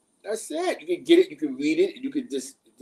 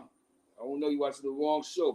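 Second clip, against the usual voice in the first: ordinary talk, nothing marked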